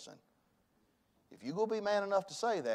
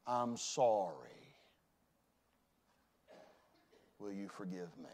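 soft, low plea for forgiveness; hushed sincere apology; humble apology; 8 semitones lower, 6 dB quieter than usual. Shown as a demonstration. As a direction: ask forgiveness quietly.

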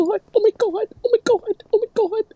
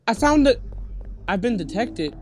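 ordinary talk

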